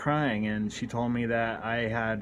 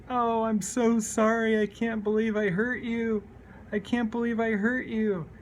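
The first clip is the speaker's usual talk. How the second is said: crying apology; 11 semitones higher than usual. Retold or imitated. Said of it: "I cried".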